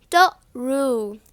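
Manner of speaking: "The ru" as tuh, pause, roo; separated TR. Retold or imitated. Teacher imitating what some people do, separated TR. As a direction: split true into two sounds, "The ru". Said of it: "separate the words".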